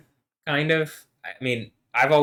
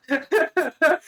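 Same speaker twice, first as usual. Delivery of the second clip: high burst of laughter. Real, not acted. Laughs at his own defiance.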